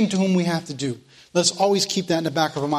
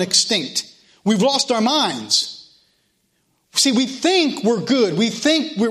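fiery preaching; 4 semitones higher than usual. Real, not acted. Preacher stirring up his Sunday school class, fired up. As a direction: hammer the point home to the congregation.

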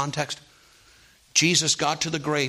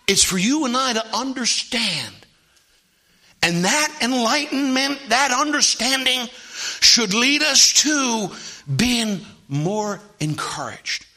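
raised, high, insistent preacher voice; full-force preaching; 10 semitones higher, 5 dB louder, slower than usual. Real, not acted. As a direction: preach it out, pitch climbing.

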